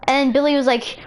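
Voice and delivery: ordinary delivery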